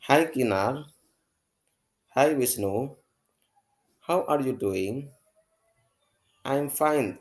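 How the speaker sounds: bright, performed classroom dialogue; acted dialogue reading. Teacher reads the Wisnu and Kinar dialogue to the class, acted out. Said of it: "saya akan bacakan dialognya ya".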